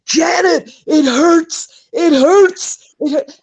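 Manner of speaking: put-on high, loud, crying Southern American kid; choking kid. Imitating the kid choking on the catapult ride, hammed-up choking wail. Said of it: "he's choking, he's going".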